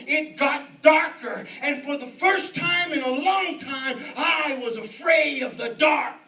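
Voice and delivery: loud, high, emphatic preacher; full-force preaching. Real, not acted. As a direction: drive each phrase home with mounting intensity. Preaches the demon story forcefully.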